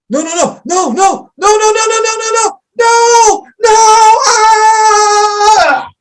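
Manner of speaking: high, loud, escalating screaming; terrified scream. Acted. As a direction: scream in rising terror. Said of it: "Great reenactment".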